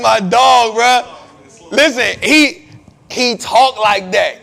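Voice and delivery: hyped laugh through the words. Real, not acted. Cracking up over his friend.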